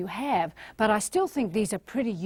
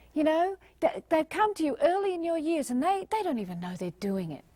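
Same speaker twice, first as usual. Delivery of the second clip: ordinary talk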